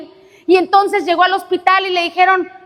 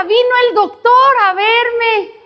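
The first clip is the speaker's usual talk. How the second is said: put-on excited child; 8 semitones higher, slower than usual. Child's happy news.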